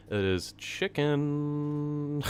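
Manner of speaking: high, slow, very drawn-out voice; silly voice. Acted. Streamer answering chat about pasta in a silly high voice. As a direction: stretch it out in a goofy high voice. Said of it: "why did I say it like that?".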